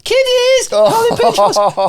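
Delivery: loud, high comic cry; playful mock shriek. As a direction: shriek it as a hammed-up gag. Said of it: "in one of my little playful M C roles".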